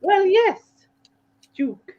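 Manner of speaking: hammed-up prim Queen; posh Queen voice. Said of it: "She would say".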